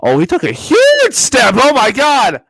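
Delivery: high, loud hammed-up gasp of awe; mock amazement. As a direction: overplay the shock, slow and loud. Mock amazement at basketball news.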